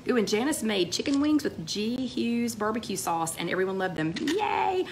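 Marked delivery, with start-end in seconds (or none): 4.2-4.9 squealed high yay; excited yay. Real, not acted. Cheering on a viewer's chicken wings, excited. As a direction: cheer it like great news.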